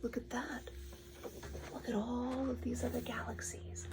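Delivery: soft amazed whisper; awed whisper. Real, not acted. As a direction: breathe it in wonder. Awed aside to child.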